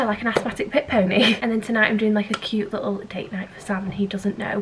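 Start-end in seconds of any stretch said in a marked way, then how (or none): none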